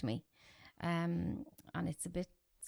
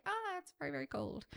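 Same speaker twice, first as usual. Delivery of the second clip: sudden high yelp of cold shock on Ah; a gasping squeal at icy water; 8 semitones higher than usual. Retold or imitated.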